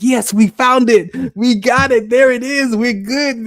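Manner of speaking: high, loud celebration; triumphant middle mind. Retold or imitated. Middle mind celebrating the breakthrough, loud and triumphant. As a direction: crow over the find, pitch well up. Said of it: "My middle mind is like".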